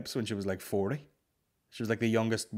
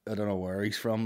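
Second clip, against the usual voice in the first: ordinary talk, nothing marked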